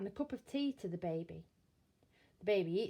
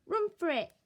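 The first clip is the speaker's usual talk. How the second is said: put-on baby parroting; 9 semitones higher, 5 dB louder than usual. Baby repeats Bill's words.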